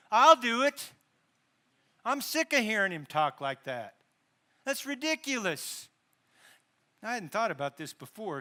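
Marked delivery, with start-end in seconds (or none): 0.1-5.9 loud, defiant, higher burst of resolve; defiant outburst. Retold or imitated. David refusing to let the giant mock God, loud and defiant. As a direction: blurt it out like a fed-up young hero. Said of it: "his attitude caused him to say".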